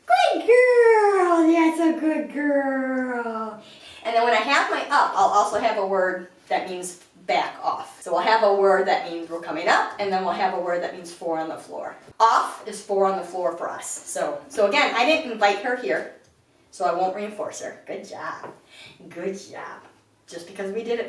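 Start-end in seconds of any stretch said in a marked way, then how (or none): 0.1-3.6 much higher and louder, stretched slowly; excited high dog-praise voice; puppy praise. Real, not acted. 17.9-19.8 soft praise. Real, not acted. Calm praise for the puppy.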